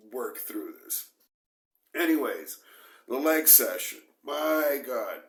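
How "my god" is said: ordinary talk, nothing marked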